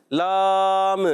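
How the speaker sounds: لام with a false bounce at the end; demonstrating a wrong echoing لام; wrong qalqala. Shown as a demonstration. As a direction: add a wrong echo to لام. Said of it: "غلط ہو گیا".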